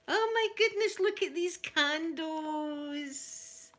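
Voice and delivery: high, drawn-out delight; real thrilled high exclamation; delighted gasp. Real, not acted. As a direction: squeal over the candles. Unboxing a gift, delighted gasp.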